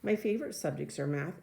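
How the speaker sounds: usual speaking voice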